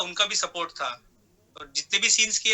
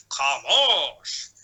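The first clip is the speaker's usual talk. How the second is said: lower, drawn-out commanding impression; mimicked catchphrase; 4 semitones lower, 4 dB louder, much slower than usual. Mimic showing an old impression, drawn-out commanding film-star voice. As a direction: stretch the word long and slow, mock-imperious.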